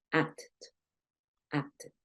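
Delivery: "acted" in two syllables, softly, ending in "ed"; ED as full syllable. Shown as a demonstration. Teacher models past tense for learners, soft "acted" with sounded ED. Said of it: "vas a pronunciar esa E D muy parecido".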